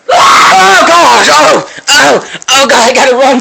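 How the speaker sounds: high, very loud panicked yell; terrified scream. Real, not acted. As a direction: scream and bolt in fright. Jump scare.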